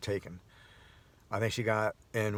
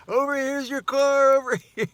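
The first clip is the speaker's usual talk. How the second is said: calling out; 9 dB louder than usual. Real, not acted. Man calling a lost shopper to her car, loud high call. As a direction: wave her over, calling.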